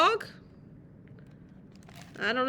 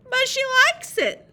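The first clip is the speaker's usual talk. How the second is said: put-on high, loud little-boy protest; child's excuse; 11 semitones higher, 7 dB louder than usual. A narrator's reading. Reader voices David excusing pulling the cat's tail, high loud voice. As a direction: plead it loudly in a kid's voice.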